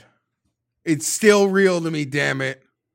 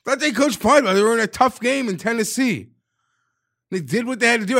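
higher and louder worked-up ranting; heated loud rant; 9 semitones higher, 5 dB louder than usual. Real, not acted. Fan venting about the coaches, heated loud rant. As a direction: vent it hard and fast.

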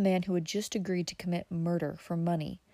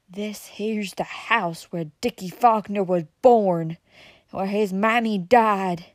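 slow, louder mountain drawl, rising with feeling; moonshiner's slow revelation; 2 semitones higher, 8 dB louder than usual. A narrator's reading. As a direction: lean into it, heavy and grave. Outlaw reveals his tie, emotional.

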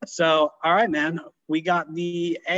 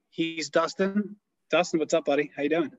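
usual speaking voice

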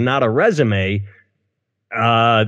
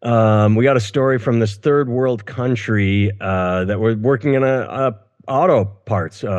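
usual speaking voice

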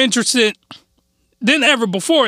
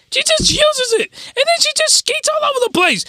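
voice going high. Real, not acted. Excited high retelling of scene.

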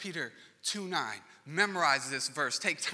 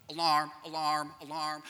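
mock alarm. Imitating phone alarm.

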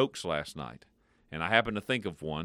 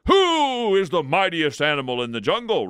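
loud, higher lion's roar; 9 semitones higher, 7 dB louder than usual. Acted. Lion roaring at the wildebeest, loud and higher. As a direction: bellow it like a swaggering lion. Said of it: "roared the lion".

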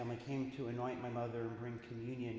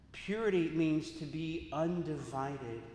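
normal talking voice

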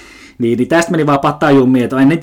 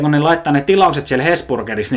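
ordinary talk, nothing marked

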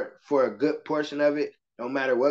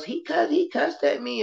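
high-pitched little tattletale voice; squeaky schoolgirl complaint, imitated; tattling kid; 13 semitones higher than usual. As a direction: mimic a child running to tell on someone. Imitates young girls tattling.

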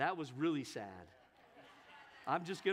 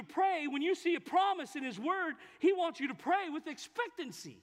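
rising, louder preacher's fervor; full-force preaching climbing higher and louder; 8 semitones higher, 7 dB louder than usual. Real, not acted.

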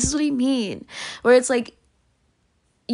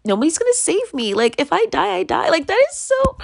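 usual speaking voice

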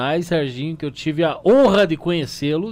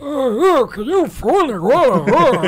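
whiny pleading; 8 semitones higher, 4 dB louder, slower than usual. Pretend. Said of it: "Como é que ele tá falando?".

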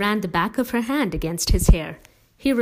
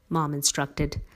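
plain reading voice

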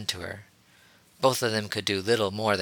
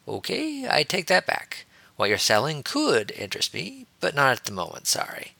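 high-pitched opening, then settling; blunt backpedal; 6 semitones higher than usual. A narrator's reading. Harry to the Twi'lek he eyed, blunt backpedal. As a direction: take it back with a teasing lift. Character backs down, bluntly flirting.

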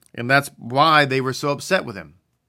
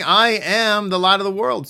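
higher, emphatic proclamation with "I" stressed; raised voice, preacher building up; proclaiming declaration; 6 semitones higher than usual. Retold or imitated. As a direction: announce it like a public declaration. Messiah declaring in temple courtyard.

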